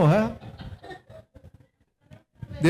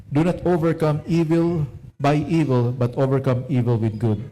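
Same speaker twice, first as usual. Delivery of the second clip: measured, louder Bible reading to the church; scripture reading; 4 dB louder than usual. Acted. Pastor reading Romans to the congregation, solemn and deliberate.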